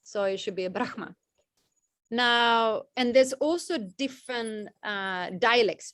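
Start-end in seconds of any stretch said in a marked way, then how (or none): 0.7-1.2 "Brahma" said BRAKH-ma, h before the m; pronounced as written. Shown as a demonstration. Teacher quoting some people's view, "Brahma" as written, BRAKH-ma. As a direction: say "Brahma" letter by letter, h then m. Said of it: "it's pronounced how it's, uh, written".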